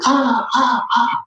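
high fading echo of the yell. Retold or imitated. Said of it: "In the background, you hear".

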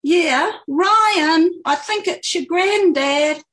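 loud, high call into the house. A narrator's reading. Girl calls into the house.

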